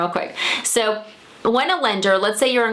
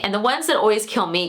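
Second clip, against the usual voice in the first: usual speaking voice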